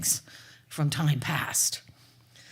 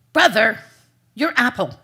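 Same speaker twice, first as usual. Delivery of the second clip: put-on louder, sharper call to the boy; impatient call; 4 semitones higher, 9 dB louder than usual. Stepmother calling the silent boy, impatient and loud. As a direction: snap at him to take the apple.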